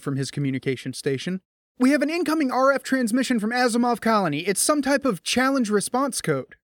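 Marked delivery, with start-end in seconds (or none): 1.8-6.6 urgent report. A narrator's reading. Lieutenant reports urgent transmission.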